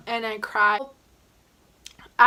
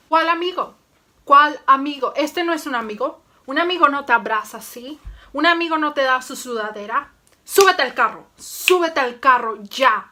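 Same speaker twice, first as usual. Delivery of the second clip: loud, high, fast Spanish scolding; angry dad in Spanish; 4 semitones higher, 8 dB louder, faster than usual. Retold or imitated. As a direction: order her into the car furiously. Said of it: "let me do a recreation".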